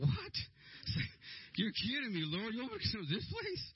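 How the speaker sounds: high disbelieving squeak; what, you're kidding me, Lord; shocked cry to the Lord; incredulous. Retold or imitated. Shocked at the rundown neighborhood.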